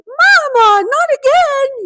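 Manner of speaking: put-on high, loud whiny child's complaint; a kid groaning at mom's clumsiness again; high, loud child's whine of disappointment; exasperated little daughter. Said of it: "And she's like".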